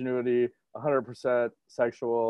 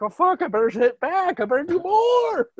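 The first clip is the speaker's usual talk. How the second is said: much higher, strained mock urgency; fired-up fighter, acted. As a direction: act a fighter spurred on by the crowd. Acting a fighter spurred by crowd.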